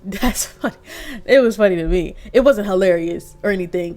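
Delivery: ordinary talk, nothing marked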